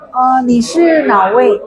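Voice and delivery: slowed, careful asking; polite question. Shown as a demonstration. Teacher modelling asking someone directly, slow. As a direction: ask gently and slowly. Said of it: "saya mau tanya".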